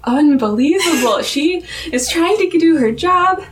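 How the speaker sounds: high-pitched, drawn-out retold exasperation; mock indignation. Acts out Kelly's exasperated complaint.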